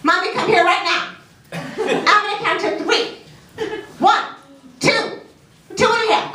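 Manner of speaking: put-on high demanding little kid counting; bossy child voice. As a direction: play the toddler ordering mom around. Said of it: "this is what I woke up to every day".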